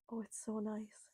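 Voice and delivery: breathy hushed whisper, savouring it. Real, not acted. Host whispering to herself about the rain, hushed whisper. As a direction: whisper it to yourself like a secret delight.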